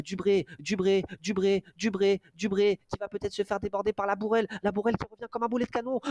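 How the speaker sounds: frantic sprint call building to a yell; race caller. Real, not acted. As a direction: call the photo finish rising in excitement. Live sprint finish call, building.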